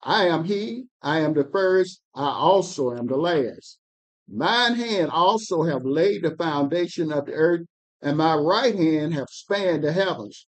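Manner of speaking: measured, solemn Bible reading; scripture reading. Acted. Reader to study group, solemn scripture reading. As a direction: read the prophet's words with weight.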